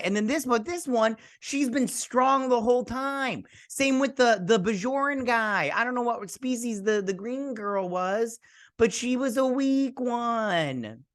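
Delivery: high-pitched, wailing complaint; comic indignation. Real, not acted. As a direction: argue your case in a squeal, stretching the last words. Still protesting favorite's death.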